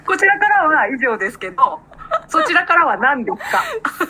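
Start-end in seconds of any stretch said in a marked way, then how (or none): 2.3-4.1 そちらからは何ですか？ pressed hard, higher with a laugh; pushy pressure. Shown as a demonstration. Co-host pushes her partner to share, mock pressure. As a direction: lean on そちら like a demand. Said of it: "すげえ圧が来る".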